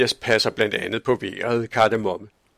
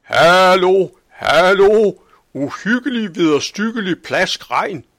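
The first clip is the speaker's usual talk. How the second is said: very loud, high alarmed shout; old man yelling a rain alarm from the balcony; shouting as loud as he can; 8 semitones higher, 8 dB louder than usual. A narrator's reading. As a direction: bellow the warning to the whole town. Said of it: "råbte så højt han kunne".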